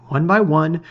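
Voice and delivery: plain reading voice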